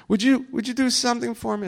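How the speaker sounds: high, quick eager request; pleading fan. Retold or imitated. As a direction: imitate an excited guest asking a favour. Retold guest begging a favour.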